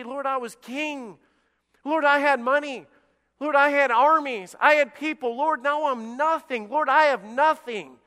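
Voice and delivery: high, loud cry to the Lord; impassioned plea. Acted. David crying out to God over all he lost, impassioned.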